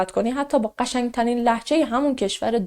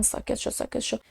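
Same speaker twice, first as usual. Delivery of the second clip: retold hushed "be quiet"; hushing; 12 dB quieter than usual. Everyone trying to silence the one speaking up, hushed. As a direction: hush them under your breath, much softer.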